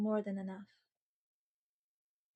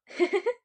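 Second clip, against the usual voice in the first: high laugh; 10 semitones higher, 8 dB louder than usual. Real, not acted. Laughs about freezer full of rolls.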